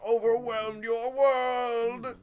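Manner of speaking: slow, hammed-up villain boom; a hint of a dark lord. Voicing Dormammu as fish.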